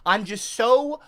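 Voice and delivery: normal talking voice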